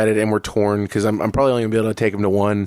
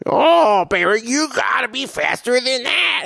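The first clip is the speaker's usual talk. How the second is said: high, condescending mock voice; villain mentor taunting Barry, high mock voice; 14 semitones higher, slower than usual. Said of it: "And he's all like".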